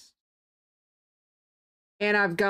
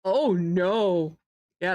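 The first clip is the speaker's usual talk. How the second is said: usual speaking voice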